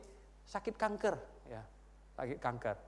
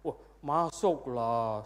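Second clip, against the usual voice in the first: long drawn-out, lower, self-assured reply; breezily sure; 4 semitones lower, 3 dB louder, much slower than usual. Acted. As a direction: stretch the words with mock confidence. Acted self-assured churchgoer's answer.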